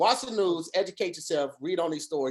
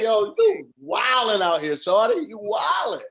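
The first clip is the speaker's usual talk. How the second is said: louder, drawn-out call-out; mock call-out; 3 semitones higher, 4 dB louder, slower than usual. Yelling at the woman in the video, loud and playful. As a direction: shout at her playfully.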